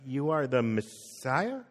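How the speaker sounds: slow, soft, rising at the end; hesitant guess. Retold or imitated. Peter unsure of his answer to Jesus, hesitant.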